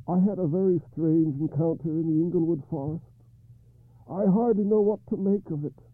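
high, troubled king; King Arthur voice. Acted.

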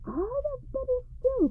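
high delighted cry of a cheery wife. Acted. Wife cries out in delight at the trade. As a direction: squeal it with joy. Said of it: "cried the wife".

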